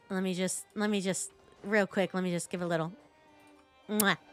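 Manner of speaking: usual speaking voice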